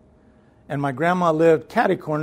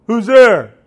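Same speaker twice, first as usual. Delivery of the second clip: loud, higher-pitched gruff challenge; imitated grandma hollering; 6 semitones higher, 11 dB louder than usual. Grandma demanding who is knocking, hollered imitation. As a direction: call out sharply through a closed door.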